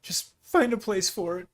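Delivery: waving him away while holding back tears; flustered, brushing off. Acted. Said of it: "She goes".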